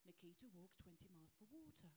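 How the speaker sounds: low, soft appeal voice-over; charity advert voice. Acted. Comedian parodying an African water charity advert, low solemn voice-over. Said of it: "you can just see the advert".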